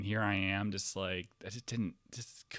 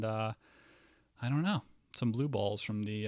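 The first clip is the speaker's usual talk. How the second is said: ordinary delivery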